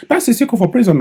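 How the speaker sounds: high put-on American voice; mock American accent. Vlogger mocking a politician's speech, high mock American accent. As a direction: ham up the foreign accent. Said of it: "you hear accent".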